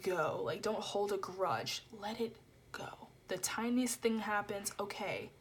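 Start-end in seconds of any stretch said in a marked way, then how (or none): 2.0-3.1 breathy whispered let it go. Real, not acted. Whispering advice to her viewers. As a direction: whisper it gently.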